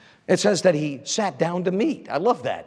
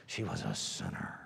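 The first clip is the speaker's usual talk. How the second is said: very low and much softer, almost breathed; hushed drop; 19 dB quieter than usual. Acted. Preacher telling the church she was a sinner, hushed and low. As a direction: let the word sink to a low hush. Dramatic drop on key word.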